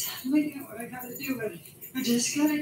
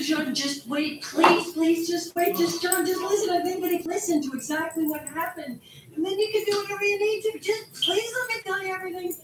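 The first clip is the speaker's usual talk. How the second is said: raised voice, begging partner to wait; urgent pleading; 4 semitones higher, 4 dB louder than usual. Acted. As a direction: plead insistently, rushing towards the end. Begging partner to stay and listen.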